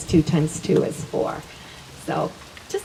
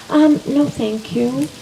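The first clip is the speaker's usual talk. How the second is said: firm; 5 semitones higher, slower than usual. Retold or imitated. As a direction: refuse with assured energy. Firm refusal to lawyer.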